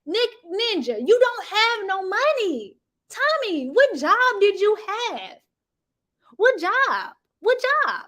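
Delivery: roasting the husband, high mocking; mocking taunt. Real, not acted. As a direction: jeer at him in a high voice. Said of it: "mocking taunt at the husband".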